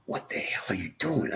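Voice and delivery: unvoiced hush to himself; whispered self-rebuke. Catching himself opening the wine, whispering in shock. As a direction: whisper it in disbelief at yourself.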